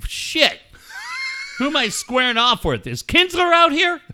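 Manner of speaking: high, loud panicked yell; mock alarmed shout. A shortstop imagining a brawl, shouting in alarm. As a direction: shout it like you're scared to fight. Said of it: "I'm like".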